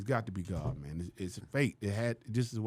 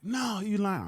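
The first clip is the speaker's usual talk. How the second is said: high, incredulous "no, you lying"; wife scoffing in disbelief; disbelieving; 10 semitones higher, much slower than usual. Retold or imitated. Wife doubting his story, high incredulous scoff.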